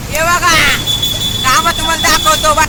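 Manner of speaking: loud, high calls to hear the echo; shouting in the tunnel. Real, not acted. Vlogger shouting to viewers inside a tunnel, loud and high. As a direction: yell it out so it booms off the tunnel walls.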